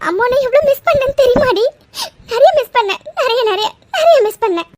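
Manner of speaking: high-pitched, emotional gush; tearful reunion. Acted. Mother telling returned daughter she missed her, tearful. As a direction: overcome with missing your child, near tears. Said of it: "இப்படி அழுதா எப்படி".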